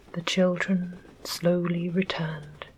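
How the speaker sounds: plain reading voice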